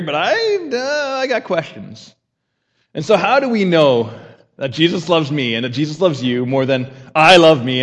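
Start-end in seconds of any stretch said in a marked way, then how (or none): 0.0-2.1 doubtful voice. Pretend.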